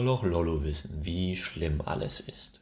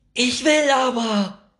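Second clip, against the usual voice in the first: screaming; 13 dB louder than usual. Acted. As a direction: howl it in a full tantrum. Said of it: "schreit Nora".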